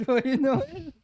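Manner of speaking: laughing through the greeting. Pretend. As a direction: echo it while giggling.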